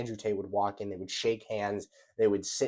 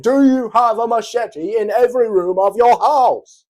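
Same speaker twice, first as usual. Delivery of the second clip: much higher, much louder British shout; mock furious British yell; 12 semitones higher, 15 dB louder than usual. Andrew Tate repeating machete question, hammed-up British yell. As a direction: scream the machete question like a cartoon tough guy. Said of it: "I said".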